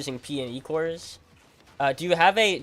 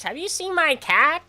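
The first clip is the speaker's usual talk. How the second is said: much higher and slower, loud old-man impression; old man's voice; 14 semitones higher, 8 dB louder, slower than usual. Retelling the old neighbor asking about his cat, high and slow. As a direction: ask it like the doddering old neighbor. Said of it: "this is the way he talks".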